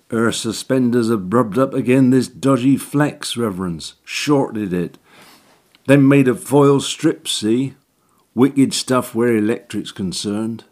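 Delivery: know-it-all workman, rough dialect; cockney handyman. Acted. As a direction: explain it like a chancer electrician. Orton explaining the short.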